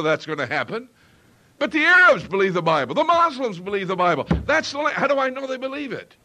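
ordinary delivery

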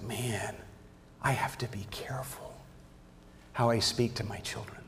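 very soft, half-whispered thought; retold inner warning, near whisper; hushed self-reproach. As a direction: murmur it to yourself, sobered. Retells his own sobering realization.

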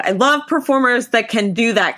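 normal talking voice

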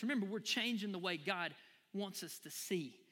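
ordinary talk

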